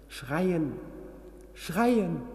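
cries rising to a high, loud scream; building scream. Acted. Patient screaming in his nightmare, building to a scream. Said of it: "und du musst schreien".

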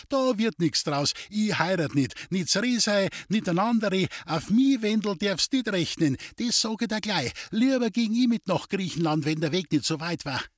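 protesting Lindl. A narrator's reading. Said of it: "rief Lindl". (hoch gesprochener, energischer Protest)

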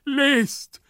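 high, loud imperative cry; theatrical high-pitched shout; desperate call. Acted. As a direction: cry it out urgently, twice. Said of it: "rief Gumpellino".

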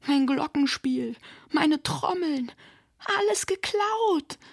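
high, drawn-out wail of despair; desperate cry. A narrator's reading. Tamara searches her wrecked percussion pile, crying out in despair. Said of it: "rief sie verzweifelt".

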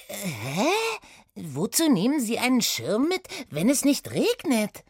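hohe, verwunderte Mädchenstimme; Oda, verwundert. Acted. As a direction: frag verwundert als kleines Mädchen. Oda wundert sich. (Oda wundert sich über Satie, hoch und verwundert)

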